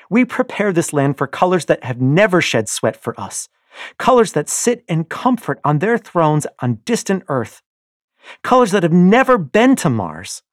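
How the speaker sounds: wife rails against the Golds, loud and bitter; impassioned rebel wife. Acted. Io's furious speech on the land.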